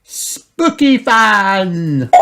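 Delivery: put-on high, very slow curious girl; Peppa voice. A narrator's reading. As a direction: ask it slowly and eagerly. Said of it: "asked Peppa".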